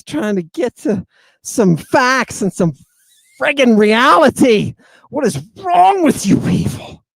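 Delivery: loud, high exasperated outburst; exasperated shout. Real, not acted. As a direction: throw up your hands and yell it. Exasperated rant about Jerusalem.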